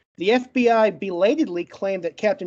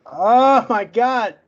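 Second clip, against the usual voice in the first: slow, louder, drawn-out sigh of relief; exasperated relief; 2 semitones higher, 5 dB louder, much slower than usual. Real, not acted. Finally past the stuck name, he groans a slow relieved oh my God. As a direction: stretch it out, relieved it's over.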